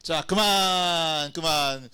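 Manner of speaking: slow, drawn-out shout to stop; 그만 외치기; loud stop call. Real, not acted. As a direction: stretch the word out to halt the room. Stopping the game.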